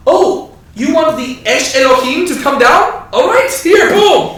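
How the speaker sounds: a hammed-up oblivious prophet; mock mishearing Elijah. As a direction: play dumb, cheerfully misunderstanding. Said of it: "he's almost acting like he misheard them".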